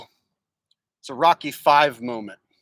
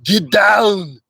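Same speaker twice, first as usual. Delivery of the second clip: punchy Austrian-accented "Get down", louder; Arnold line; 4 semitones higher, 5 dB louder than usual. Shown as a demonstration. Teaching viewers the easy Arnold line, Austrian accent. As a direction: bark it like Arnold. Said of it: "start with the easy stuff".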